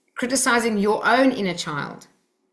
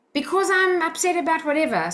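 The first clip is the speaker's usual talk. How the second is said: higher-pitched defensive protest; defensive child; 8 semitones higher than usual. Acted. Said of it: "so they become defensive".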